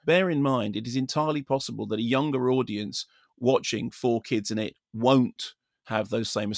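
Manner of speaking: ordinary talk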